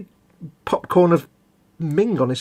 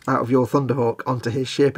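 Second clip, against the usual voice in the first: ordinary delivery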